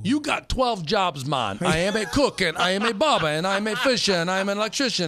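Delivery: high, loud sitcom character voice; Damon Wayans bit. Retold or imitated. Host mimics a TV comic listing his jobs. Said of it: "you remind me of? Damon Wayans".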